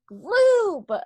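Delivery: sudden full-throated yell, high and loud; one-word furious yell at the boyfriend; screamed. Retold or imitated. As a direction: scream the word at him like you've had enough. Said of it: "and I screamed at him".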